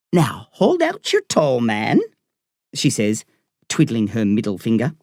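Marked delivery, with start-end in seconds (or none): 0.1-2.0 slow Irish doctor voice; Irish accent. A narrator's reading. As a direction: instruct the young patient slowly in an Irish accent. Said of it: "I love her Irish accent".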